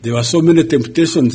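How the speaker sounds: normal talking voice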